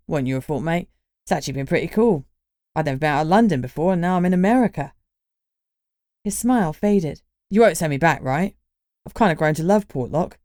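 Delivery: quick, chatty Cockney lad, lightly voiced; Cockney accent. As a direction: rattle it off in easy Cockney. Reggie's Cockney voice, fast.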